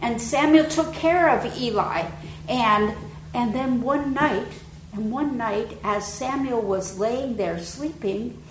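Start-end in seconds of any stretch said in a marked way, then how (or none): none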